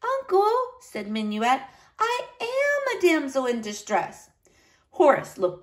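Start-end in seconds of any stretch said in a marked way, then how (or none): 0.0-0.5 put-on high, light little-girl voice; little niece, matter-of-fact. A narrator's reading. Niece telling uncle about the flag, high child's voice. 2.0-4.3 put-on high child's voice, leaning on AM; little niece, insistent. A narrator's reading. Niece correcting her uncle, insistent child's voice.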